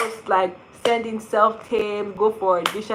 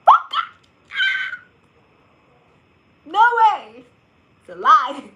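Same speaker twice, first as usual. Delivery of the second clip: much higher, louder stunned exclaiming; starstruck disbelief; 13 semitones higher, 9 dB louder than usual. Retold or imitated. Inner voice on meeting Gabriel, high stunned disbelief. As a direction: shriek inwardly at the star. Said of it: "in my mind, I'm like".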